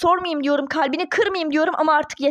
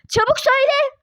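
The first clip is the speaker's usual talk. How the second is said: high, loud snapped command; sharp yelled order; 8 semitones higher, 3 dB louder than usual. Acted. Duru losing patience with the silent boy, yelling. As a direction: bark the order at him.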